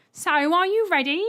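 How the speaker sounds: high, loud and cheerful, unaware of anything wrong; joyful, oblivious. Acted. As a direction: chirp it out happily. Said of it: "in a joyful, oblivious voice".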